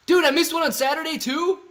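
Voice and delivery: high, loud, incredulous question; can't believe he missed it; dismayed surprise. Real, not acted. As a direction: blurt it out, disbelieving, pitch way up. Learns he missed another super chat.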